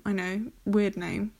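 ordinary delivery

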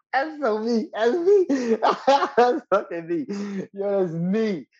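high, loud laughing recognition; excited laughing agreement; laughing hard. Real, not acted. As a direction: laugh it out, totally relating. Laughing recognition after partner's story.

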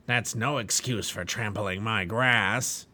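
slow, rough-voiced giant scolding; the giant growling about his grass; giant's voice. Giant Despair scolding the trespassing pilgrims, slow giant voice. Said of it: "said Giant Despair".

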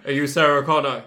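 loud, flat killer robot; Terminator voice. Pretend. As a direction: deliver it as a cold robot assassin. Acting out the Terminator's line.

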